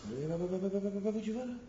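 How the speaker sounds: high, incredulous bah bah, pitch climbing; rising mock disbelief; mock bewildered calling after. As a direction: call after someone who walks off, half amused. Mock reaction to storming off.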